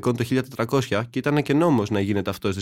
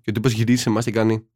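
ordinary talk

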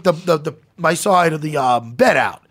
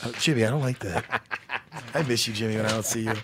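laughing mock tender; 6 semitones lower, 3 dB quieter than usual.

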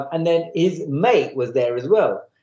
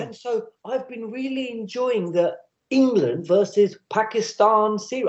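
slow, over-careful speech; lightly imitating a man speaking down slowly; mock slow patronising; 4 semitones higher, slower than usual. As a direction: talk slowly as if to a learner. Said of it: "he starts speaking slowly to me".

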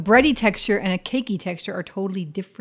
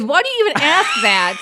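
teasing shout; 7 semitones higher, 6 dB louder than usual. Real, not acted. Teasing friend about recording.

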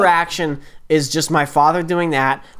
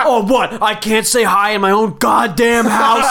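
mock outraged dad; 4 semitones higher than usual. Imitating dad arguing with younger sister, mock outrage. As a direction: act out an offended father shouting.

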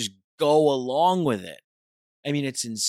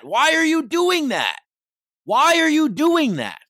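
much higher, louder outburst; exasperated shout; 13 semitones higher, 7 dB louder than usual. Real, not acted. Ranting at people who just go along, loud and high. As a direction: yell it in disbelief.

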